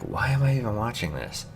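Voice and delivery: baffled disbelief. Retold or imitated. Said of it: "you're like".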